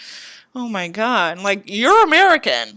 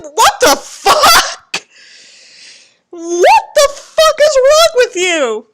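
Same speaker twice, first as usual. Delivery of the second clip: shocked outburst; 12 semitones higher, 6 dB louder, much slower than usual. Real, not acted. Reader shocked by the story.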